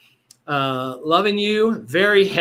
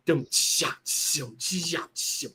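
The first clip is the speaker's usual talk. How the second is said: vocal drum beat; 6 dB quieter, slower than usual. Retold or imitated. Imitating a disco drum beat.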